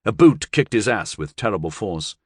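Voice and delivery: ordinary delivery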